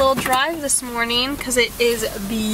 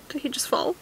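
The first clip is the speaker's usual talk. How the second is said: whispering; 3 semitones higher, 7 dB quieter, faster than usual. Real, not acted. Watching him surf.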